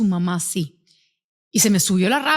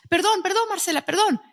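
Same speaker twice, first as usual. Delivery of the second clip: put-on high, hurried little-girl apology; a young daughter apologising anxiously to her sister; pleading apology; 10 semitones higher than usual. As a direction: beg forgiveness in a high small voice. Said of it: "le dice a Raquel".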